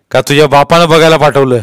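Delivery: stern interrogator; police inspector. A narrator's reading. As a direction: push a suspicion at the child.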